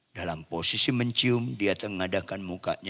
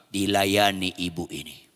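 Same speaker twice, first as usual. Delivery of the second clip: normal talking voice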